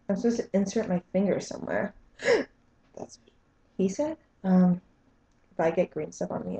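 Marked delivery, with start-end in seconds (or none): 2.1-4.2 gasping, much higher, a joking shocked voice; mock gasp. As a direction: play up the fake shock at the joke. Joke on "insert your finger.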